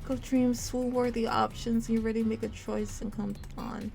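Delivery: ordinary talk, nothing marked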